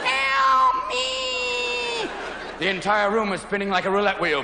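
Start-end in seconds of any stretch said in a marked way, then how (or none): 0.0-2.1 high, held-out plea for help; high drawn wail; mock cry for help. Comic imagining a helpless victim, high wailing plea.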